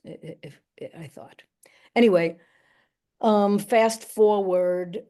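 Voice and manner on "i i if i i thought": whispered aside. Real, not acted. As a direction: drop to a near-silent whisper, a little ashamed. Embarrassed aside about her parents.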